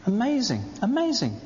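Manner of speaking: high, marvelling cry of amazed; rising wonder at the clip; amazed exclamation. Acted. Preacher exclaims over a video.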